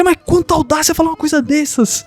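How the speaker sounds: offended servant voice. Acted. Servo ofendido.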